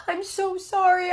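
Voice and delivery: put-on high, tearful little girl voice, a bit slow; crying child apology. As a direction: plead through tears like a small child. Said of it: "and she's just crying".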